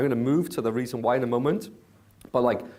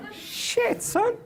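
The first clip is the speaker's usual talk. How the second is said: high astonished shit son; stunned exclamation. Real, not acted. Reacting to Jay's slap-down, high and astonished. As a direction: gasp it out amazed, very high.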